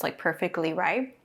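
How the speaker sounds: usual speaking voice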